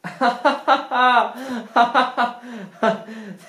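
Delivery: high, long fake laugh; forced laugh. Pretending Tony's joke was funny, forced laughter. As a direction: laugh too hard at a bad joke.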